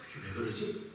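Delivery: whispered concern over a growing lump; worried whisper. Retold or imitated. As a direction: mutter it to yourself, uneasy. Self-talk about a lump.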